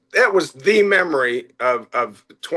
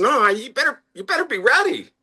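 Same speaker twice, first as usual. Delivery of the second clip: insistent warning; 11 semitones higher than usual. Retold or imitated. Owner warning doubtful staff to be ready, insistent high warning. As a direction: pitch jumps high as he insists they be ready.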